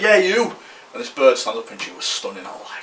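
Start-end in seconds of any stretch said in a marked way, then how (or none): 0.0-0.6 pitched-up, louder imitated call; mock shouting over. Girl calling to him across college, mock shout. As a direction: holler it across at one person. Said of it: "like, shouting over to all lads".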